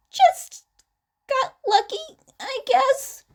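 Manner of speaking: high, awkward voice; nervous deflection. A narrator's reading. Izuku brushes off the fan's question, high and nervous. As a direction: squeak it out nervously.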